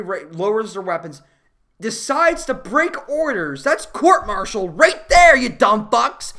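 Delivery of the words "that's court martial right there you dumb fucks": louder, slow and indignant; louder, slow exasperated outburst; exasperated shout. Real, not acted. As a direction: yell at the soldiers on screen. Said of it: "I can't stress that enough".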